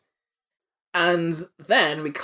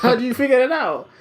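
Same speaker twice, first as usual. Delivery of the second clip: high, louder, laughing disbelief; baffled villain, cracking up; pitched-up question breaking into a laugh; mock astonishment through laughter; 8 semitones higher, 5 dB louder than usual.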